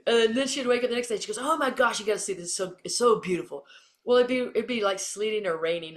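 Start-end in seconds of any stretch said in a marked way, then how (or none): 1.4-3.7 imitated delighted awe; mock wide-eyed wonder; gushing teammate. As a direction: gush like a morning enthusiast. Voices teammate admiring the view.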